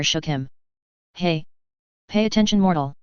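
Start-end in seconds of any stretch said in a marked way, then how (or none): 1.1-3.0 growled. A narrator's reading. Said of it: "The vampire growled".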